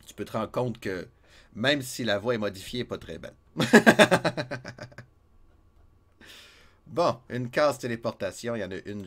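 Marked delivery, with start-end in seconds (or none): 3.5-5.0 big loud laugh, pitched up; loud burst of laughter. Real, not acted. Streamer laughing at own modified voice, loud and high.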